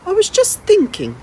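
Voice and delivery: put-on an old lady teasing a runaway boy, then inviting him in; lightly voiced old lady.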